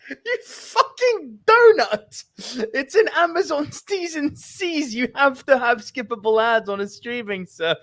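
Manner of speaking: high-pitched, exasperated ranting; exasperated outburst. Real, not acted. Friend berating co-host over skippable ads, high exasperated outburst. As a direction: blow up at your mate's stupidity.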